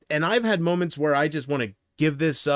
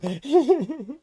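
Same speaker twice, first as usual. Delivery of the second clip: high giggling laugh; high, helpless tittering; real laughter; 10 semitones higher than usual. Real, not acted. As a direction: keep cracking up. Cracks up after mock rant.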